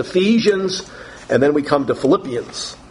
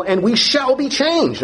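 lifted high and slow, leaning hard on changed; emphatic; 8 semitones higher than usual. Acted. Preacher reading Paul's words to the congregation, emphatic and raised. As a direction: proclaim "changed" like a promise. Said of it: "Paul is emphatic about this".

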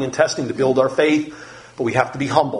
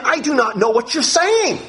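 Peter denying; 11 semitones higher than usual. Acted. Peter's denial voiced.

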